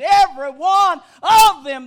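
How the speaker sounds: "Every one of them" shouted with weight on each beat; emphatic preaching. Real, not acted. Preacher repeating the emphasis to the flock, shouted "Every one of them". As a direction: drive home "Every one of them" at full voice. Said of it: "is the emphasis".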